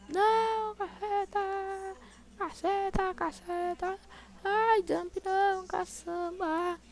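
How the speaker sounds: high panicked slow wail; being chased. Real, not acted. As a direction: squeal in panic, stretching words. Mob attacks in game.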